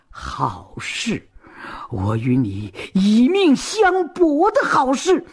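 scornful, then fierce threat; cold fury. A narrator's reading. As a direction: spit the words back, building to deadly defiance. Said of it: "冷笑几声".

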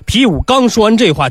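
plain reading voice

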